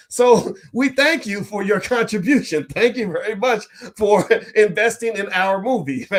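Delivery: high-pitched thanks broken by laughter; amused, teasing thanks. Real, not acted. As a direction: thank them while cracking up. Jokingly thanking viewers as shareholder.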